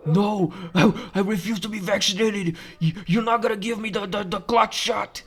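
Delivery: high, strained protesting patient voice; panicked refusal. Acted. Patient refusing the doctor's shot, mock panic. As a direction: plead in a high put-on voice, dragging out the end.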